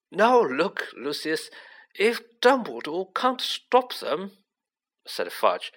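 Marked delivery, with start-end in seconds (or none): 0.1-4.4 higher, flustered pleading; a touch of panic; nervous official. A narrator's reading. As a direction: protest like a rattled minister. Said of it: "whose upper lip was sweating now".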